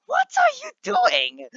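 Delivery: very high, slow, drawn-out mock teacher screech; mock teacher yelling. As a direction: imitate an outraged teacher, stretching each word. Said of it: "she was yelling at me".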